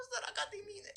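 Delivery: high-pitched mock self-pity. Pupil pities herself after quarrel.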